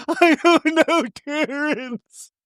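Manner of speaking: high, slow whimper; mock crying. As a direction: imitate a tearful whine, high and slow. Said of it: "imitating crying Lisa".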